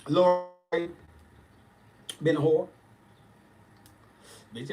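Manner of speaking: usual speaking voice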